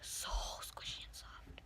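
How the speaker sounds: soft breathy whisper, almost no voice. Acted. Whispering to viewers about the squishy. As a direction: hush it like an ASMR aside.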